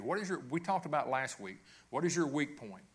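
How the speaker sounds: normal talking voice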